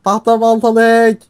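high, stretched-out cry; alarmed calling out. Real, not acted. Player chased by hacker warns friend, alarmed calling. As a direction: wail a warning to your teammate.

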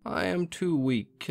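high, slow frail voice; lightly voiced sick old woman; weak grandmother. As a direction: sound too weak to rise.

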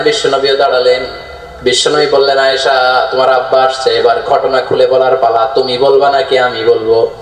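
sustained sing-song narration, sinking low; melodic sermon storytelling. Acted. Preacher telling how the father was summoned, melodic narration. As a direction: intone the tale like a chanting preacher.

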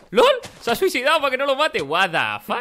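loud, high disbelief; incredulous gamer exclamation; astonished outburst. Real, not acted. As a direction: blurt it out in real shock. Opponent suddenly kills himself in game.

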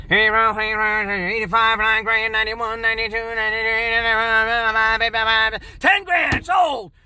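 droning rapid auction chant, jumping to a shouted sold; auctioneer. Retold or imitated. Tape of auctioneer replayed.

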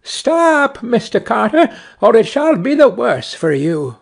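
high, urgent warning from a little sparrow; high-pitched storybook cry. A narrator's reading. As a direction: call out a warning, pitched well up. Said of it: "cried out".

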